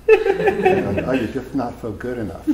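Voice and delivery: very high, lilting, laughing lisp; mocking fairy voice on the inner critic; fairy filter voice. Shown as a demonstration. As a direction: flamboyant lisping lift. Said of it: "fairy filter ... put the lisp on there".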